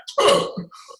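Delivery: loud high hiccup. Acted. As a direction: hiccup bursts out after relax. Hiccups interrupting his talk.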